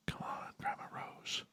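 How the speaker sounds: soft urgent whisper. Real, not acted. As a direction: whisper it under your breath. Host urging call, whispered.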